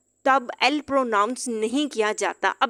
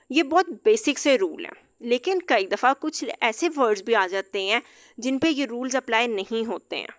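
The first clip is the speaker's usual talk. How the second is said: normal talking voice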